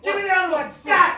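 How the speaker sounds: very loud, high command; hammed-up emergency panic; frantic shout. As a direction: yell orders mid-rescue. Frantic rescuer demanding the other shoe.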